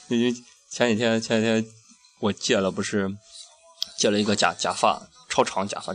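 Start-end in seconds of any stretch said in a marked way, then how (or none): none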